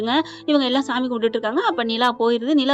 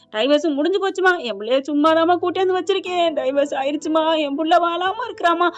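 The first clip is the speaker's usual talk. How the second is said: tearful wailing; 4 semitones higher than usual. A narrator's reading. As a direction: lament it like a crying mother. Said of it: "அப்படின்னு அழுகுறாங்க". (அழுது புலம்பும் அம்மாவின் நடிப்புக் குரல்)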